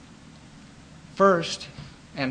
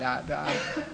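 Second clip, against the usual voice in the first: ordinary talk, nothing marked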